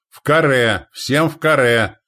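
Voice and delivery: loud, high, drawn-out command; full-force shout. Acted. Commander forming a square, full-force shout. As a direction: shout with all your might. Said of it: "прокричал он, что было сил".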